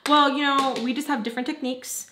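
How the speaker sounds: high, sugary over-polite oh okay; fake kind politeness. Explaining to the client in a sweetly fake tone. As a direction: put on a sweet polite front. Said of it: "I kindly explained to her".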